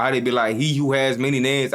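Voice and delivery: ordinary talk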